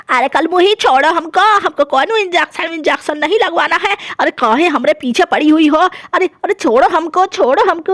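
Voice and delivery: a cranky dadi just woken up, scolding; grumpy old grandmother. Acted. Grandmother caught, protests. (गुस्सैल बूढ़ी दादी की आवाज़)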